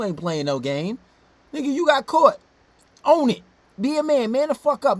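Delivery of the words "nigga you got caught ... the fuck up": loud, higher scolding rant; shouting orders at a cheater on screen; yelling at him. Real, not acted. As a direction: tell him off hard, raising your voice.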